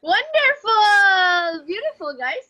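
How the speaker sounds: shower them with praise; delighted praise. Real, not acted. Teacher praising students' skit.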